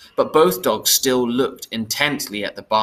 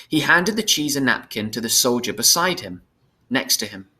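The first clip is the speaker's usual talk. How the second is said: ordinary delivery, nothing marked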